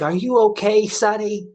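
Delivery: put-on a high old lady voice. Telling the story, he voices the kind old lady asking if he's okay, high and sweet. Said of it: "she comes up and she's like".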